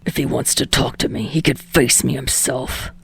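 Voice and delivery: snarled. Acted. Bakugo answering Deku's call, low snarl. Bakugo rejecting All Might.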